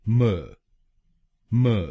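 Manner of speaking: म said as a short neutral "muh"; just a light म, muh; syllable muh. Shown as a demonstration. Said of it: "हम बोलेंगे". (दूसरा शब्दांश म)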